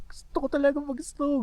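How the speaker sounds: high, pleading for snow; excited. Real, not acted. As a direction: beg to play in the snow. Eager wish, high and loud.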